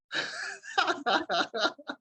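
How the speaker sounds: a real high-pitched burst of laughter. Real, not acted. Laughing at his own story.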